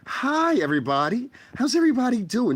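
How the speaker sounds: very high, sugary mock greeting; fake sweet streamer voice. Vlogger mocking a fake streamer's sugary greeting, very high. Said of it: "if you talk like this".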